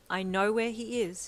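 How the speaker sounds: said brightly. Acted. Editor calling back with good news, bright and loud. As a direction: announce it with a bright lift. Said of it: "he said brightly".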